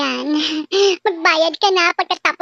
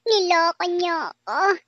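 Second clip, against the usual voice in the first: usual speaking voice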